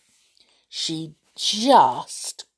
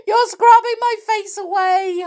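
high, loud wail; 6 dB louder than usual. A narrator's reading. Amelia wailing at Tom scrubbing too hard. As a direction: wail in misery. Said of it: "wailed poor Amelia".

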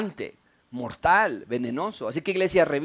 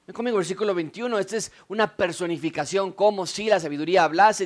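ordinary talk, nothing marked

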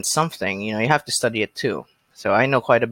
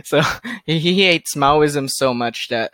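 ordinary delivery

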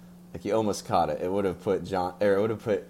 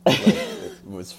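laughing; 8 dB louder, much slower than usual. Real, not acted. Laughing at the manager special joke.

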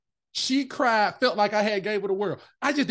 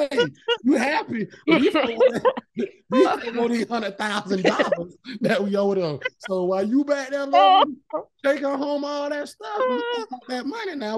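mock teasing coworker; 7 semitones higher, 5 dB louder than usual. Teasing coworker at staff meeting.

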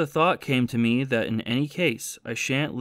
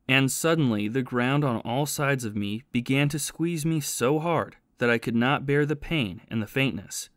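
usual speaking voice

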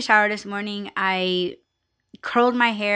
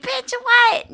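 high, loud bitch what; affronted, pitched way up; indignant disbelief; 5 dB louder than usual. Retold or imitated. Reacting to nail lady's question.

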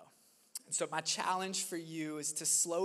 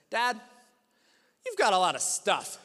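put-on louder, a loud child's blunt remark; son's blunt remark; 10 dB louder than usual. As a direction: voice the boy announcing it bluntly. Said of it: "and he says".

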